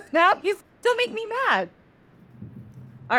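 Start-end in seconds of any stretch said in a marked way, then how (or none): 0.1-1.7 high-pitched, amused delivery; laughing. Real, not acted. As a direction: talk through a laugh while mocking a threat. Laughing while voicing angry trickster.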